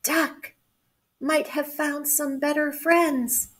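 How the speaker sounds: sad little voice. A narrator's reading. Cat fearing duck left for better friends, sad little voice. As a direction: say it sadly, little and high. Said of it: "whispered in a sad little voice".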